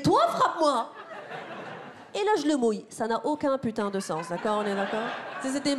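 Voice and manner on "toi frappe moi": mock man's dare. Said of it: "il m'a dit".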